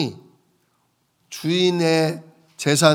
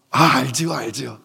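eager debtor; 3 semitones lower than usual. Acted. Debtor eagerly agrees with steward.

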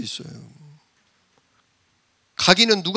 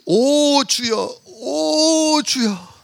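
slow, drawn-out, higher lament; groaning Moses; 7 semitones higher, much slower than usual. Retold or imitated. Moses groans to the Lord before protesting, slow high lament. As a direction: wail it out like a reluctant Moses.

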